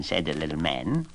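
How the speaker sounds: ordinary delivery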